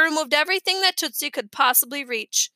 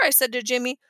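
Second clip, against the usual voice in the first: plain reading voice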